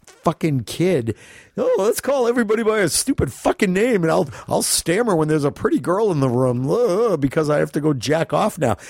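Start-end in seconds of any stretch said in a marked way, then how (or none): 1.6-8.8 high, goofy mocking voice of a nerdy kid. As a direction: lampoon the awkward sidekick, pitched way up.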